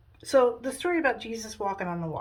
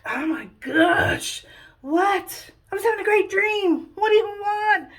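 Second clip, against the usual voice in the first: whiny, high-pitched exasperation; put-upon sleeper, rudely woken; woken-up annoyance; 10 semitones higher, 4 dB louder, slower than usual. Acted. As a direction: drag out the complaint, then rise loud and high. Jesus woken from a dream.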